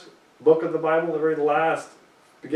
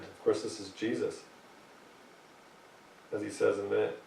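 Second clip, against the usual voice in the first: ordinary delivery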